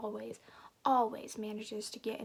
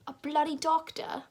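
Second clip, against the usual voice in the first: louder, higher British exclamation; mock-British indignation, pitched up; British accent; 6 semitones higher, 7 dB louder than usual. Reader voicing incredulous Uncle Anton, British accent. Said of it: "do I have to do a British accent?".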